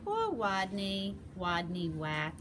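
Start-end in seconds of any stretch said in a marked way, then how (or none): none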